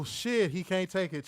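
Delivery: ordinary talk, nothing marked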